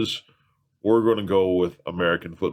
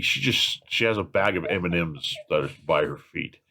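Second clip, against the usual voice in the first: ordinary talk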